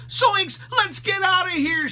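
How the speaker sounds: high, loud panicked cry; scared cry. A narrator's reading. Shaggy crying out at the monsters, high and loud. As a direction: yell it fleeing the monsters. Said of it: "Shaggy cried".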